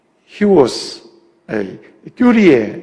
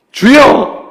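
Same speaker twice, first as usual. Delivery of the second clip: loud call of Lord; pleading cry; 10 semitones higher, 10 dB louder, much slower than usual. Acted. Pastor voicing the woman's cry, loud plea. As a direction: cry out for mercy.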